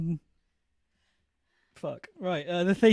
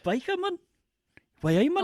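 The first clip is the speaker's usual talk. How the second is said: put-on northern accent; 8 semitones higher, 4 dB quieter than usual. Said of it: "fighting so much not to do the accent".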